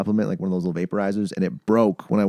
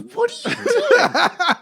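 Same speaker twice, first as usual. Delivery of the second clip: much higher and very slow, laughing through it; laughing coworker; 3 dB louder, much slower than usual. Retold or imitated. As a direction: ask it cracking up in disbelief. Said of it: "He goes".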